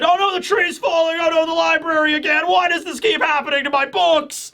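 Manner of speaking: high-pitched hammed-up wail of dismay; comic cry of despair; mock panic. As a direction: play-act a distraught victim, way up high. Mock outcry over imagined library disaster.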